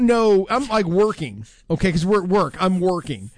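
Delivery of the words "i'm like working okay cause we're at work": normal talking voice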